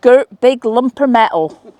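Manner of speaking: put-on best Bristolian accent. Echoing a companion's phrase for fun, hammed-up Bristolian accent. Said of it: "that's my best Bristolian accent".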